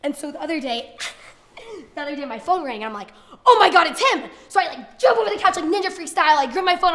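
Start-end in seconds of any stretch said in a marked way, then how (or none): 3.4-4.3 much louder, high-pitched squeal of hope; excited shriek. Retold or imitated. Hoping her crush is calling, excited shriek. Acting out thrill at phone ringing. 4.5-6.9 loud, high, action-packed rush; breathless excited telling. Acted. Recounting the leap for her phone, loud excited telling.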